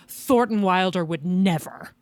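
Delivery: loud, slow indignant declaration; hammed-up offended purist, drawn out; mock outrage. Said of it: "and then you were like".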